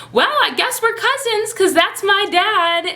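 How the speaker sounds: high-pitched, tentative announcement; nervous reveal. Retold or imitated. Acting out nervous reveal to cousin.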